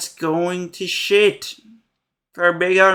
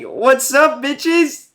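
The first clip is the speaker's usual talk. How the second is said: higher, louder cocky greeting; hammed-up taunting villain, much higher; mock taunt; 7 semitones higher, 3 dB louder, much slower than usual. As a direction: swagger in like the villain showing up. [name] taunting after tipping counter.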